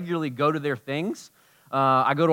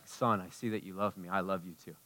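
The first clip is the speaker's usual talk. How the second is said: voicing God gently; 7 semitones lower, 11 dB quieter than usual. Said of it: "all he wants to do is be like".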